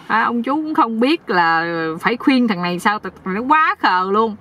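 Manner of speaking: ordinary delivery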